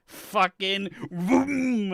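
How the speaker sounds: drawn out mock car vroom; slow drawn vroom. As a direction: stretch the engine noise. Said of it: "car noise imitation".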